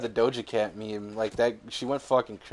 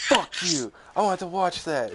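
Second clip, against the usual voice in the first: higher-pitched retold protest; mock angry moviegoer; 6 semitones higher than usual. Moviegoer to prankster who spoiled it, mock angry. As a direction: imitate an upset fan's complaint. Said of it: "He was so fucking mad".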